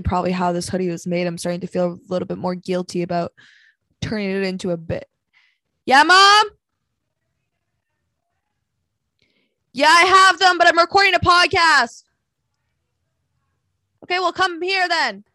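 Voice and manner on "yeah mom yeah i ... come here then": loud call toward another room, pitched high; raised voice answering a parent offstage; calling out to Mom. Real, not acted. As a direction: shout up to Mom from the desk.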